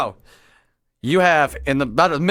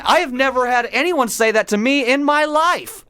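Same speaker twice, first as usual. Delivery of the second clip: high-pitched, emphatic disbelief; incredulous rant, pitched up; exasperated; 6 semitones higher than usual. Real, not acted. Exasperated rant about unnatural dialogue.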